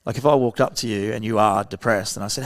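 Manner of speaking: normal talking voice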